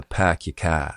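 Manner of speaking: Boston fronted "ah", no R's; non-rhotic Boston, front vowels; Boston accent. Shown as a demonstration. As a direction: demonstrate a Boston accent with tongue cupped forward. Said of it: "In Boston, it's a front vowel".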